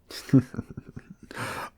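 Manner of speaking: breathy unvoiced cackle; wolfish cackle. A narrator's reading. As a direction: let out a sly beastly cackle. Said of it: "he proceeded to cackle".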